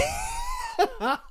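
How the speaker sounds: high shrieking laugh half crying; a disbelieving shriek of a laugh. Real, not acted. Vlogger cry-laughing at a story, shrieking.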